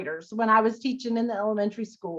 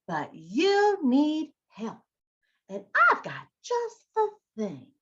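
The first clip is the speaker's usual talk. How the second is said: higher, drawn-out salesman fox; sly fox pitch; 4 semitones higher, slower than usual. Acted. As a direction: smoothly tempt the bear.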